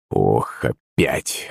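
low, quiet groan of protest; weary exasperation. A narrator's reading. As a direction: sigh it out low, fed up. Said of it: "закатил глаза Прокофий Андреевич".

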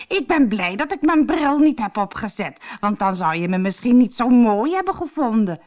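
high, loud mock-bride voice; squeaky disguise voice. Harlekijn in disguise teases captain, squeaky voice. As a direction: prattle as a squeaky fake bride. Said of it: "Ik piep zo".

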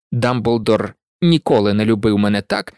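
plain reading voice

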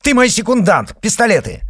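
screamed, very high and loud; yelling. Acted. Izotov yelling for Sokolov to be his second. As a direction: yell his name across the room.

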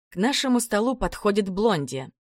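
ordinary delivery, nothing marked

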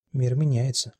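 plain reading voice

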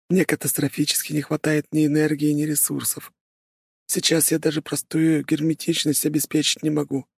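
high, even machine-like voice; calm artificial helper voice; AI companion voice. Acted. AI companion Avrum speaking.

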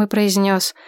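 plain reading voice

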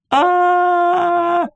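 shrill one-note scream, very high; piercing scream. Acted. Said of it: "Пронзительно раздалось на одной ноте".

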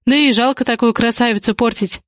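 ordinary delivery, nothing marked

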